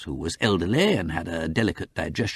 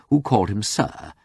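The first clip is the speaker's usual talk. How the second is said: plain reading voice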